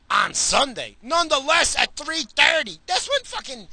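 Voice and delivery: loud sports-fan griping; indignant rant. Real, not acted. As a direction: hammer out the complaint in disbelief. Rant about NBA game times.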